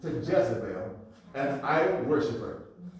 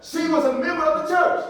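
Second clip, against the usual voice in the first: raised, driving preacher's emphasis; emphatic; 13 semitones higher, 11 dB louder than usual. Shown as a demonstration. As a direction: hammer the whole statement home at higher pitch. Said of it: "I gotta emphasize".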